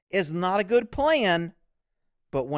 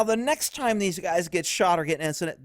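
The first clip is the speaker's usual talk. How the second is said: ordinary talk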